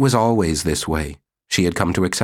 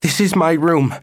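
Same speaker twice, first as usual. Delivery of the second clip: higher, out-of-breath "get out"; panting yell; 9 semitones higher, 3 dB louder than usual. Acted. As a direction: pant it at the intruding wife. Susie yells at wife.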